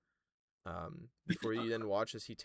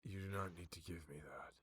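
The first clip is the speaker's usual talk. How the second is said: softer, grave herald; quiet and solemn, a touch lower; the Herald's voice; 3 semitones lower, 9 dB quieter than usual. Acted.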